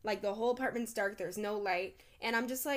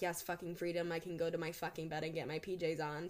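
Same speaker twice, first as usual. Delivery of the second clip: softer, lower private relief; muttered relief; 5 semitones lower, 6 dB quieter than usual. Retold or imitated. Heading home from a party and relieved, softer and lower. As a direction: savor the freedom under your breath.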